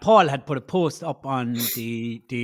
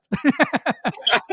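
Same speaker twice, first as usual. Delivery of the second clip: a higher, bright burst of laughter; happy laugh; 9 semitones higher, 3 dB louder than usual. Real, not acted. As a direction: let a real laugh out. Laughs after the story.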